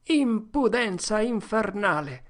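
scornful outburst, slowed; indignant. Acted. Bystander scorning Potter's nerve, slow and high. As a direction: spit out the outrage. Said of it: "disse uno dei presenti".

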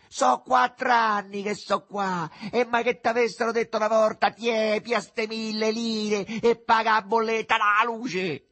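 loud, high, sustained complaint; mother yelling after. A narrator's reading. Mother complains about unpaid bills, shouting. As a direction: keep railing at full pitch. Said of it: "le gridò dietro la madre".

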